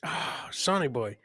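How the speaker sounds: ordinary talk, nothing marked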